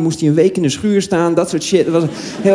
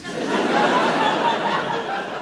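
laugh; 7 dB quieter than usual. Real, not acted. Laugh after whisper joke.

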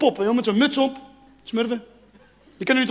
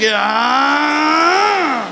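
hammed-up squealed yes; mock gushing wail; 3 semitones higher, 13 dB louder than usual. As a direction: wail the word out, swooping upward. Mock delight at mewing kittens.

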